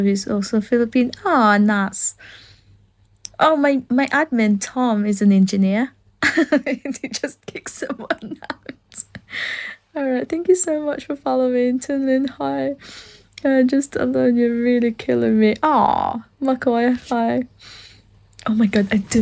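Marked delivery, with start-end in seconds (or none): none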